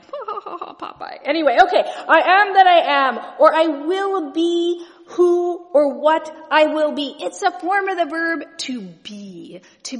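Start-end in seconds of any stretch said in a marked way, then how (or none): 0.0-1.2 a breathy, mocking little laugh at Popeye. In Hebrew class, laughing at Popeye's name. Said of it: "I shouldn't be laughing". 2.1-7.2 loud and slow, weighty proclamation; proclaiming. Shown as a demonstration. Preacher to congregation, booming out God's name. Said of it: "proclaiming God's name".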